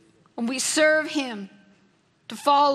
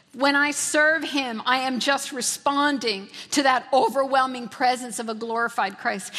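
ordinary talk

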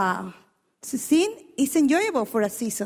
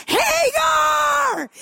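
much higher, loud shout of the name; Sarah calling; 12 semitones higher, 4 dB louder than usual. Retold or imitated. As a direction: yell "Hagar!" across the house, louder and higher. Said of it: "I can imagine Sarah going".

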